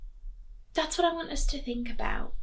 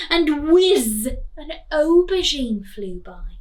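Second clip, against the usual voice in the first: louder, bouncy read-aloud of the action; lively action narration; 4 semitones higher, 6 dB louder than usual. A narrator's reading. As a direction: punch each food hitting Dave. Teacher reading picture book aloud.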